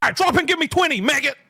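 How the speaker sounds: barking drill sergeant orders. Acted. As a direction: yell it like a drill instructor. Drill sergeant orders push ups.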